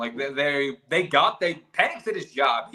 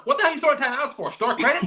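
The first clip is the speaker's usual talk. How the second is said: higher, quickened incredulous rant; sarcastic disbelief, pitched up; mock exasperation; 8 semitones higher than usual. Fan ribbing a coach over wasted timeouts, mock exasperated.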